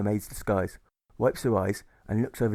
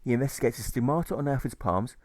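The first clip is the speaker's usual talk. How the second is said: usual speaking voice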